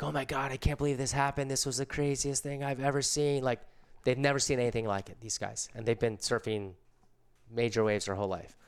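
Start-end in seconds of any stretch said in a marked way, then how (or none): 0.0-3.4 higher, awestruck surfer voices; mock amazement, higher; amazed surfers. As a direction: sound blown away, pitched up. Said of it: "The guys are like".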